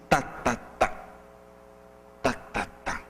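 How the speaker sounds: tá-tá-tá sumindo até quase sussurro; marcando o ritmo. Shown as a demonstration. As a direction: bate o ritmo e vai apagando. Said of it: "Tem uns que estão no ritmo assim". (ritmo batido, sumindo)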